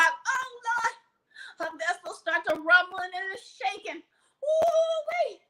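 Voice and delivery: high cries of alarm; hammed-up panic as labor starts; mock panic. Preacher acting the woman going into labor, high mock cry.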